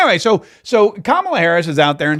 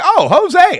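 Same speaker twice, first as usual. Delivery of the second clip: high, loud burst of pretend recognition; mock delight; 13 semitones higher, 5 dB louder than usual. Host recalls mishearing a tequila name, mock delight. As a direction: exclaim it like greeting an old favorite.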